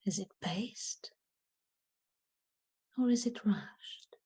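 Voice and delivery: unhurried, softening as the questions go on; guided meditation. Acted.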